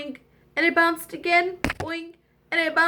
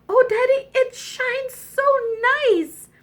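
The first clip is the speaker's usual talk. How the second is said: high, louder princess voice; high-pitched childlike wonder; delighted princess; 10 semitones higher, 4 dB louder than usual. Acted. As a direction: gush over a shiny treasure. Princess admires the gold ball.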